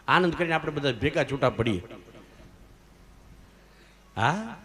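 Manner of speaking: usual speaking voice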